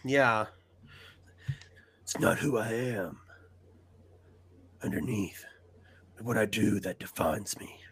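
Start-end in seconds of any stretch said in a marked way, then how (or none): 2.0-7.8 low, hushed hero whisper; Batman. Acted. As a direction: drop to a low, soft whisper with pauses. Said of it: "that one".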